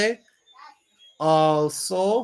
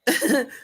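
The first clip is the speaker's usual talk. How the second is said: short real burst of laughter; 9 semitones higher, 3 dB louder than usual. Real, not acted. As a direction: laugh out at the chat. Laughs at chat comment.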